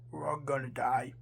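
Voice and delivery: hopeless whisper. A narrator's reading. Professor whispering that everyone will die. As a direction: whisper it like all hope is gone. Professor despairs, all doomed.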